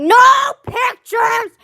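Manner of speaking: high, loud mock yell; sudden shout. Student retelling the teacher's outburst, in a sudden high shout. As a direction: blurt it out like a startled teacher. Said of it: "and they're like".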